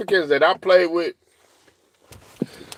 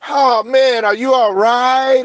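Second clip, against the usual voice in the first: high, louder, slow fake concern; mock caring teammate; 10 semitones higher, 5 dB louder, slower than usual. The reaction he never got, high hammed-up concern. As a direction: ham up the polite concern, pitched way up. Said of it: "ain't no".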